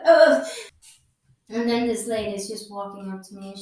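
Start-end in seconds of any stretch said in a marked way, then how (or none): 0.0-0.5 loud retold groan of disgust; pained groan. Seeing the blood, a loud groaning ugh. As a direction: let out a sharp groan at the sight. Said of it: "and I'm like".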